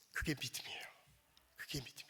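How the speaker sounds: hushed whisper, almost no voice. Real, not acted. Pastor hushing the congregation on faith, whispered. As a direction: drop to a whisper to land the point. Pastor closes point in hush.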